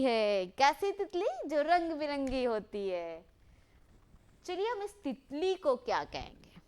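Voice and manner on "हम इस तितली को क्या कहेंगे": ordinary talk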